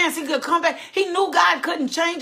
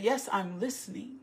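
soft listening reply; 5 semitones lower, 11 dB quieter than usual. Acted. Abraham answers the angel softly.

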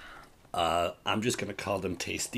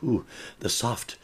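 ordinary talk, nothing marked

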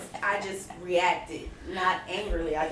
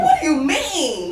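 loud, high shocked question with a laugh; retelling the shock to friends, loud and laughing; 5 semitones higher, 6 dB louder than usual. Retold or imitated. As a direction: demand what she meant. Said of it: "And I was like".

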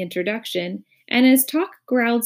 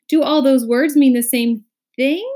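higher, louder puzzled question; astonished gasp; 6 semitones higher, 7 dB louder than usual. A narrator's reading. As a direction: ask it in amazement. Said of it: "gasped Milo".

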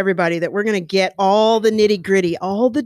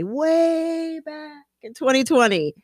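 higher and slow, stretching "way back"; dramatic repeat; 8 semitones higher than usual. Acted. Host teasing how long ago she promised, slow and high. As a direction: drag "way back in twenty twenty" out high and slow like ancient history.

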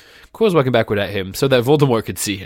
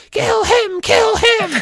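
very high, raspy villain yell; 5 dB louder, much slower than usual. A narrator's reading. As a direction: shriek the command, piercing and urgent. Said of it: "Voldemort's yells of".

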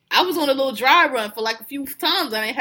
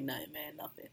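much softer, fading to almost nothing; barely audible mock innocence; hushed denial; 7 semitones lower, 20 dB quieter than usual. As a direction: mumble it away, hiding a laugh. Denying why he laughs.

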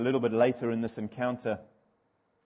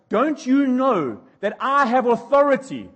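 much higher and louder, slow indignant demand; angry Pilate; 11 semitones higher, 10 dB louder than usual. Retold or imitated. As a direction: bellow it like a rattled governor. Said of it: "And Pilate goes".

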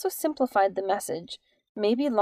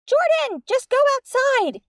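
very high squeaky voice, a bit louder; 5 dB louder than usual. Acted. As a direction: squeak it out like a tiny mouse. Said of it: "she said squeakily".

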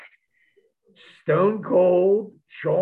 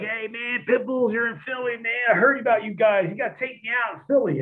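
higher, pushy party-guy voice; eager Shawn Michaels; 8 semitones higher than usual. Retold or imitated. Shawn Michaels asking the Pitbulls for a night out, higher and eager. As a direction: beg them to take you out. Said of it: "Shawn Michaels is like".

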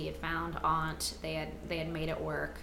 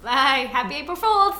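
excited shout; 8 semitones higher, 11 dB louder than usual. Real, not acted. Loud goodbye at show end.

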